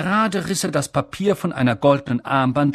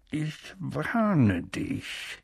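menacing warning; 7 dB quieter, much slower than usual. Acted. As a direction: press each word like a threat. Uncle threatens Harry aside.

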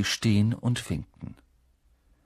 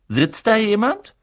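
put-on young boy asking; 12 semitones higher, 6 dB louder than usual. Shy boy asks for a seat.

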